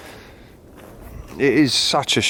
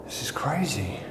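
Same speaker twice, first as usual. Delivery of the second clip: whisper; 4 semitones higher, 8 dB quieter than usual. Real, not acted. Awed at an altar in house.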